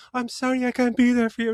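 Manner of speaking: high, mock-tearful apology; imitated grandmother's farewell. Older lady recording a message for granddaughter, mock emotional. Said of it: "she's recording her message".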